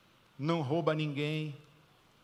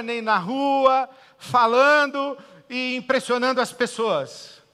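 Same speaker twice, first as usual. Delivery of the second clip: loud, high, impassioned preaching; fiery preaching; 6 semitones higher, 11 dB louder than usual. Real, not acted. Pastor preaching to the congregation, loud and fervent. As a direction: preach it full force to the church. Preaching Jesus' words at full force.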